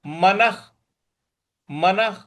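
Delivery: मनः ending in a breathy kh sound; visarga as kh. Shown as a demonstration. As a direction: close मनः with a kh, like manakh. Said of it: "उसका उच्चारण हमें ख". (मनः के विसर्ग में ख जैसी ध्वनि)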